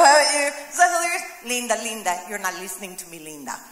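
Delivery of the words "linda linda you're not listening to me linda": viral Linda video. Retold or imitated. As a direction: let it sink deeper each time, exasperated. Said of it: "You've seen that video".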